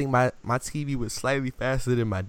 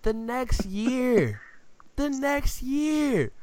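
slow, much higher repeated phrase; slow emphasis; 10 semitones higher, much slower than usual. Real, not acted. Building suspense about the Saints' next loss, slow high emphasis. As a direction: drag it out for drama. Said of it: "repeated for build-up".

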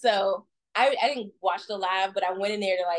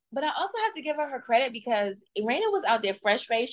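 ordinary delivery

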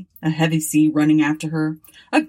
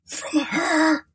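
groaned; 10 semitones higher than usual. A narrator's reading. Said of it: "groaned the man".

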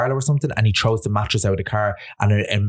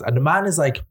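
ordinary delivery

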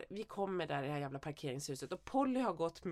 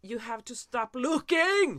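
loud, higher, drawn-out scolding yell in English; retold shout; 4 semitones higher, 9 dB louder, much slower than usual. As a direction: yell at gawking strangers, spelling out each word slowly. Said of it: "skrek jag typ så här". (återberättat skrik, högt och utdraget)

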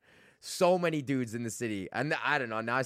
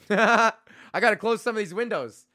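laughing; 7 semitones higher, 5 dB louder than usual. Real, not acted. Laughing at double meaning.

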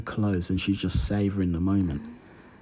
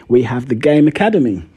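ordinary talk, nothing marked

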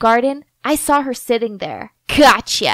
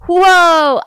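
high, loud, thrilled whoa; excited whoa; 5 semitones higher, 6 dB louder than usual. A narrator's reading. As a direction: cry out in delight. Excited at her plan working.

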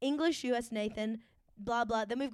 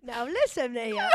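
mock British orphan. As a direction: ham it up as a British street urchin. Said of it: "like a little orphan".

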